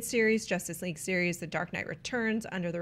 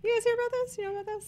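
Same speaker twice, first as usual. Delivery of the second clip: high-pitched; 13 semitones higher, much faster than usual. Acted. Host teasing news in high voice.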